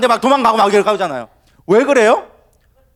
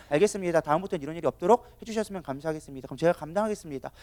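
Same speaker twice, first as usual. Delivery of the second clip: soft, restrained acceptance; calm composure; 4 semitones lower, 9 dB quieter, faster than usual. Acted. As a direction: accept it politely and quietly. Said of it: "컨트롤하는 사람들은 그렇게 하지 않아요". (차분히 받아들이는 말투)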